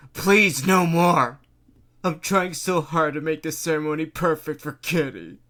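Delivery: sobbing plea. A narrator's reading. Mayor sobbing, begging the Cat Queen for mercy. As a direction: cry out begging her to stop. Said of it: "he begged, sobbing".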